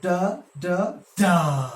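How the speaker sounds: two lighter "da, da", then a long, heavy falling "da"; anapestic beat. Shown as a demonstration. Teacher sounding out the anapestic pattern, weak-weak-strong. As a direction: land hard on the last "da" after two lighter ones. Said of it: "This pattern, which is".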